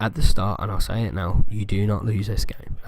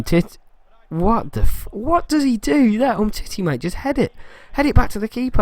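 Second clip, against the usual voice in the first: much higher, pleading exasperation; exasperated; 11 semitones higher than usual. Real, not acted. Gamer scolding his defender for the error, exasperated high pleading. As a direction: beg the defender in disbelief.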